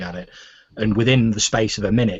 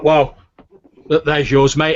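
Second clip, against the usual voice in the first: loud, higher orc barkeep; Nyog; 5 semitones higher, 8 dB louder than usual. Acted. Orc barkeep serving a porridge bowl, loud and matey. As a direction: hand it over in a raised barkeep voice. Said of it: "Nyog's like".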